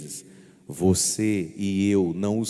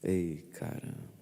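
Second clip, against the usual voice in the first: regretful sigh; 4 semitones lower, 9 dB quieter, slower than usual. Shown as a demonstration. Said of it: "Faz assim, ó".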